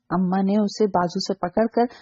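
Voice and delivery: ordinary delivery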